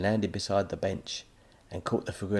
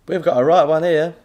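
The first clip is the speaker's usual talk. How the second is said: high, loud jeering shopkeeper voice; loud sneering burger bar man; mocking counter man; 9 semitones higher, 14 dB louder than usual. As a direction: poke fun at the odd boy.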